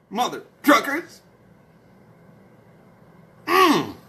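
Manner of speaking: usual speaking voice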